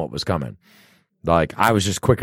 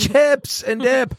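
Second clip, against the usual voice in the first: high, slow sing-song announcement; chips and dip; much slower than usual. Real, not acted. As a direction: sing it out slowly, delighted. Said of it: "how do we turn it around".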